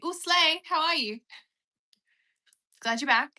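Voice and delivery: high, loud, delighted hello; excited greeting. Real, not acted. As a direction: greet a returning friend with a squeal of joy. Greeting a returning viewer excitedly.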